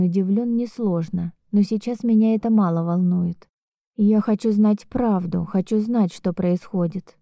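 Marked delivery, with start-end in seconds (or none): none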